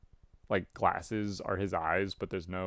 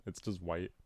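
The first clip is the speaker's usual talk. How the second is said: ordinary delivery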